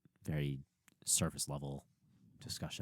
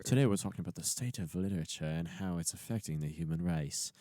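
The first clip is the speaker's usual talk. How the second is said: put-on old-timey accent sliding into Aussie; fifties accent morphing Australian. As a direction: start nineteen fifties, drift to Australian. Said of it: "That slowly morphed into Australian".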